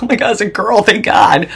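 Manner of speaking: high, loud mock delight; overjoyed relief. Mom thinks she finally has a girl, mock overjoyed. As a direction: exclaim with hammed-up relief.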